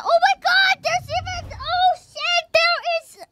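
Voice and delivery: high surprised yelp; shocked squeal. Real, not acted. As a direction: squeal at the mess you just found. Discovers milkshake on monitor.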